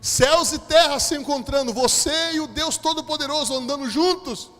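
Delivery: loud, high, drawn-out preaching; God's thunderous declaration. Retold or imitated. Preacher voices God answering him, thunderous and fervent. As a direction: proclaim it with mounting force to a loud peak.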